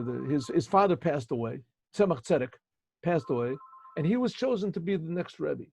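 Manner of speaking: usual speaking voice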